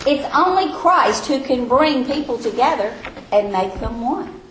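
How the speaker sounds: ordinary delivery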